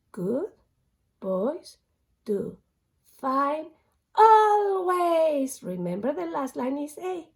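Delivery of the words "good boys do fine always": spaced single words, then a long loud always; slow counting. Shown as a demonstration. Teacher showing homework with a pencil, slow spaced chant. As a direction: count it out slowly, lifting the last word. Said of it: "and do this".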